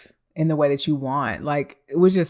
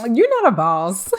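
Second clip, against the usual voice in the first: loud, higher verdict; dismissive; 4 semitones higher, 8 dB louder than usual. Retold or imitated. Telling the ex off, loud and dismissive. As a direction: dismiss him like he's beneath you.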